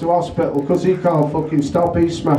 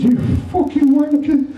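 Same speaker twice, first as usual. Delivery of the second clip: high, laughing mock-tearful insult; the swimmer with only a head bawling at the manager; crying swimmer; 10 semitones higher than usual. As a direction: wail it like a hurt kid. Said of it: "he said".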